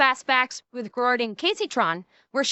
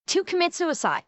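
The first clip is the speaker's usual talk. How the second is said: usual speaking voice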